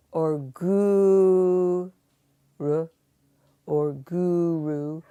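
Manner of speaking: "guru" stretched very slow and loud, wrongly; wrong drawn-out guru. Shown as a demonstration. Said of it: "it's not guru".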